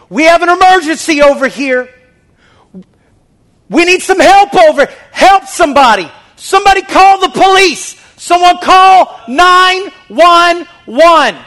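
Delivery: high, loud frantic cries for help; panicked shouting. Pretend. Preacher acts out someone screaming for help in an emergency. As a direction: yell it like a real emergency. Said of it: "No, they're like".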